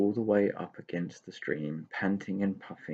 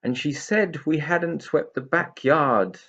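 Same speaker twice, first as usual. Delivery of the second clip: higher, louder grumbling complaint; grumbled complaint; 7 semitones higher, 6 dB louder than usual. A narrator's reading. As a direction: complain like a put-upon pixie. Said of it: "grumbled Tigger".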